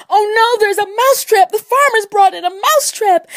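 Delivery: very high, loud wail of alarm; panicked mouse. Acted. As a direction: wail the warning as a frightened little mouse. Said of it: "he saw the pig and said".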